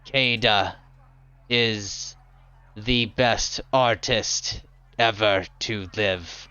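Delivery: gargoyle voice. Acted. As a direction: rumble the praise like stone. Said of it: "and says".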